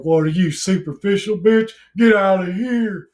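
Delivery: loud, high, hammed-up yokel outrage; angry mob heckler. Vlogger playing a straw-chewing mob turning on a commenter, mock-angry yell. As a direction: yell it like a pitchfork mob, dragging out the last words. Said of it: "turn around on her with our fucking".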